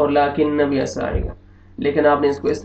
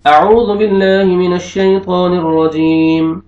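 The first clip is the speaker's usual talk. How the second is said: slow, melodic tajweed chant, higher and louder; Quranic recitation; 5 semitones higher, 6 dB louder, much slower than usual. Shown as a demonstration. Teacher recites the verses for students as a slow melodic chant. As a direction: recite the verses slowly like a qari. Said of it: "मैं पढ़ के आपको बताता हूँ".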